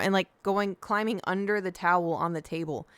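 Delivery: ordinary delivery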